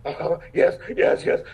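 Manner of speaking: mildly excited yeses, piling up; retold excitement. Actor imitates foreign fans recognizing his show, mildly excited. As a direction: imitate fans suddenly catching on. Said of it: "they got all excited".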